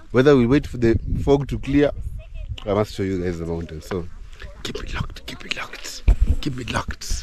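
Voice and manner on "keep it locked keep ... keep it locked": soft breathy whisper. Real, not acted. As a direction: whisper it like a secret. Whispered vlog sign-off.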